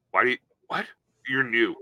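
normal talking voice

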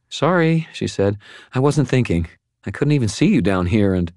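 put-on high, flustered young woman; a young woman apologizing to hurt Harry; Molly, apologetic. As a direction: rush out an apology.

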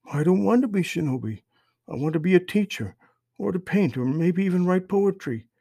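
put-on high child's voice, pleading; protesting young boy. A narrator's reading. Son telling his mother he wants another life, boyish protest. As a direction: protest like a small boy with other dreams.

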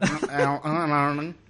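very slow nasal e-learning. Pretend. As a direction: stretch e-learning through the nose. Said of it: "acento, este, gangosote".

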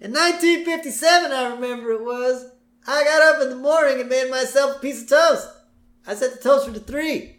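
put-on high rambling old man voice; old Grandpa voice. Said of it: "we cut to Grandpa".

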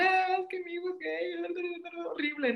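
high, tearful retelling of her own words; retold crying. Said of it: "llegué llorando". (imitando llanto de madre al contarle a su mamá)

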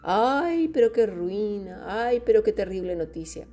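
hammed-up lament, falling in pitch; mock wailing. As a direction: moan theatrically over terrible news. Acted lament over bad news.

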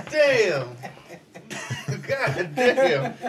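loud "damn" through real laughter; laughing hard. Real, not acted. Cracking up at a friend's joke, laughing loudly. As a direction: crack up and shout it out.

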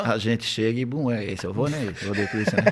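low, lightly voiced stoner rambling; mock-deep stoned musing; stoned philosopher. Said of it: "Quando ele tá na chapaceira, ele é filosofa".

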